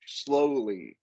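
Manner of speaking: SLOWLY stretched out long; drawn-out slowly. Real, not acted. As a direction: draw out "slowly" to act out slowness. Stretches the word to illustrate it.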